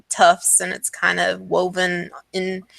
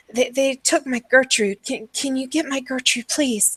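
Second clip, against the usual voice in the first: higher, halting desperate plea; pleading madwoman; 6 semitones higher than usual. Acted. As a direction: beg like a distraught mother. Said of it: "she's like".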